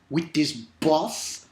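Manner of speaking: usual speaking voice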